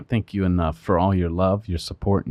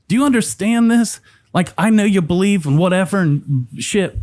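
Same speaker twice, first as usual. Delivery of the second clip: mad attorney; 7 semitones higher than usual. Retold or imitated. Attorney warns client he's facing twenty-five to life, mad. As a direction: imitate an exasperated attorney, pitched well up. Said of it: "He got mad".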